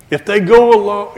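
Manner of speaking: usual speaking voice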